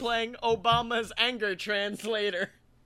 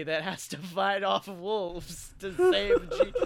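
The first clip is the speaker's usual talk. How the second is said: breaking into laughter; 2 semitones lower, slower than usual. Real, not acted. Cracks up mid-quote.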